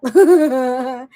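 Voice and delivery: loud burst of laughter; laughing. Real, not acted. Streamer laughing at the misheard phrase, loud laugh.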